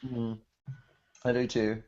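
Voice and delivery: usual speaking voice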